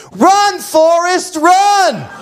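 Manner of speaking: high, loud, slow taunting yell; mocking shout. Punk kids in a car mocking a limping runner, loud jeer. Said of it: "punk kids in their car ... say".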